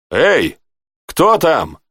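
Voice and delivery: hailing the guard; calling out. A narrator's reading. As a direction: call out to whoever is there. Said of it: "окликнул Рэй".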